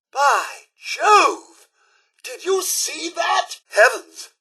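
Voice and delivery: high-pitched, astonished outburst; startled exclamation. Acted. Character reacting to a startling sight, astonished. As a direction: cry out in alarmed amazement.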